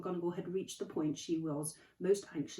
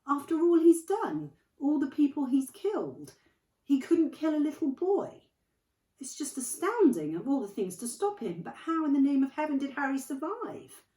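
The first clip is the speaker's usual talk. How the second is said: astounded; 10 semitones higher, 10 dB louder than usual. Acted. McGonagall astonished, raised.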